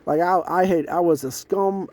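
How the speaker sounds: ordinary talk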